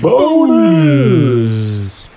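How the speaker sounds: shouted game show "Bonus"; announcer shout. Pretend. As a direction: belt it like a host.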